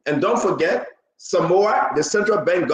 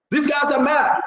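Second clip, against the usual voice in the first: exclaiming; 6 semitones higher than usual. Real, not acted. Host exclaims about party.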